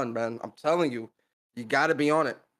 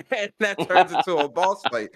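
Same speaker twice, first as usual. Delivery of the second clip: louder, higher, laughing through the words; 5 semitones higher, 5 dB louder than usual. Real, not acted. Host joking about a boss fight, laughing. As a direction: let a real laugh push the line up. Laughs at his own imagined scene.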